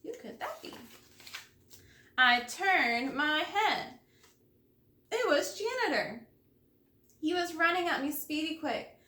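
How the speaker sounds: usual speaking voice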